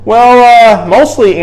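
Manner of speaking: a nervous little elf hedging to Santa; hesitant little elf. A narrator's reading. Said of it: "answered the little elf".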